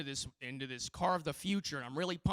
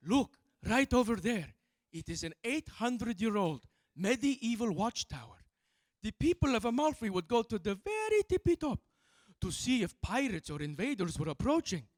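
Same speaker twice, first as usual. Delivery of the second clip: put-on higher Italian-accented guide voice, proud; Italian tour guide; 6 semitones higher, 5 dB louder than usual. Comic imitating an Amalfi tour guide to tourists, Italian accent. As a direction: show off the tower like an eager Italian guide. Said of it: "the tour guide was just like".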